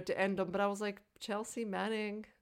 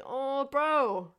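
knowing oh bro; 5 semitones higher, 4 dB louder than usual. Retold or imitated. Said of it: "I was like".

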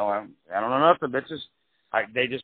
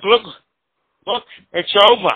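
mock firm insistence; 8 semitones higher, 9 dB louder than usual. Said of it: "He's like".

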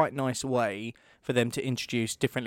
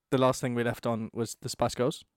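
usual speaking voice

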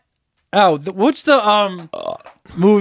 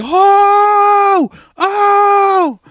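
much higher, loud laughing cry; laughing howl; 5 dB louder than usual. Retold or imitated. Remembering childhood laughter, shrieking high and loud. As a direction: howl with laughter like a little kid. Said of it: "I was like".

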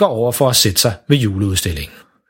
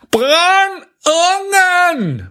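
much higher, drawn-out garbled yell; dad yelling "Brænd ungen" very slow and high; shouting at full voice; much slower than usual. Acted. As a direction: bellow it slowly across the hall. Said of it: "råber stadigvæk for fuld hals".

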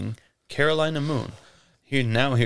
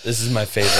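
ordinary delivery

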